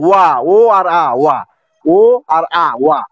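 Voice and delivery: ওয়া broken into ও and আ, then rejoined; sounding out syllables. Shown as a demonstration. Teacher breaking ওয়া into two sounds, sounded out. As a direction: stretch ও and আ apart, blend into ওয়া. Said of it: "দুই অক্ষরের উচ্চারণ".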